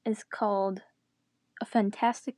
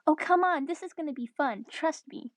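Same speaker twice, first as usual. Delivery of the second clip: higher, coaxing, lightly giggly; 6 semitones higher than usual. Acted. Chloe urges friends through the gate, high and coaxing. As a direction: coax them along, pitched up. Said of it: "Chloe giggled".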